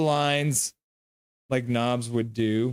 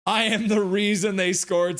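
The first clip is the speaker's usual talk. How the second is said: mock quote of Bunny Van; 7 semitones higher, 3 dB louder than usual. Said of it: "says Bunny Van".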